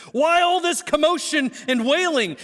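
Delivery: high-pitched, incredulous demand; exasperated challenge. Acted. Jesus confronts the wailing mourners.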